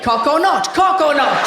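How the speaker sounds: game show host. Pretend. Pitching a game show to the audience, announcer style. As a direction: sell it like a game show title. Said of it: "excellent game show".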